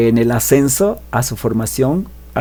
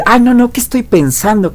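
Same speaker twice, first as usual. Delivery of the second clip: flustered correction; 10 semitones higher than usual. Retold or imitated. Blonde catches herself.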